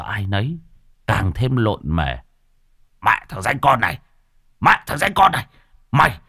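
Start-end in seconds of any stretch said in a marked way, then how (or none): 3.0-6.2 rising higher and louder insult; enraged sputter. Acted. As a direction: sputter with rage at the rascal. Lão Hói lộn mề chửi. (chửi tức tối)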